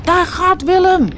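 loud, high cry; excited shout. A narrator's reading. Animals watching the lizard fly, loud excited shout. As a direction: shout as he flies past. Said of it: "hoorde ze roepen".

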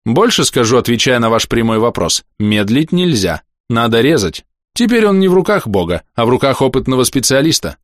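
a hint of a blunt stern physician; stern doctor voice. Доктор настаивает на операции. (лёгкий голос хмурого доктора)

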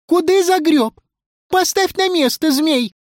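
high, scolding character voice; outraged high squawk; indignant protest. Acted. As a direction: tell him off in a high-pitched cry. Character protests at being grabbed.

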